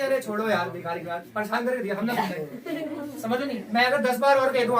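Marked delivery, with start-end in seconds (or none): 0.0-3.2 lightly voiced fed-up student, trailing off laughing; a hint of a grumbling student who won't listen; mock annoyed student. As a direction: play a bored kid brushing the teacher off. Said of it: "तो ये कहेंगे".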